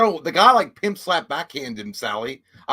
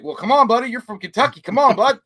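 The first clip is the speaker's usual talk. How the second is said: louder, higher urging voice; coaxing guide; 7 semitones higher, 7 dB louder than usual. Retold or imitated. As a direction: cheer him on to ride the horse. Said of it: "and he was like".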